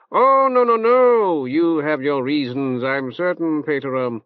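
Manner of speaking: unctuous prelate, drawn out; reverberant nasal voice. Acted. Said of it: "in a reverberant nasal voice".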